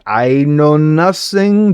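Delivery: a slow, drawn-out mock 'I know nothing'; a hint of Sergeant Schultz, stretched slow; Schultz impression. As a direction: play a bumbling guard denying everything, lightly voiced. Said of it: "Doing her best Schultz".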